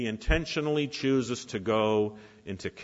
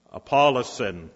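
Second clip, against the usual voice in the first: loud dismissive call; preacher cues the Apollyon side; leading the response; 2 semitones higher, 6 dB louder, slower than usual. Shown as a demonstration. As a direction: bark it back at them. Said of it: "you respond".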